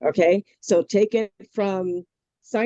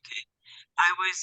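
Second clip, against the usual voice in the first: ordinary talk, nothing marked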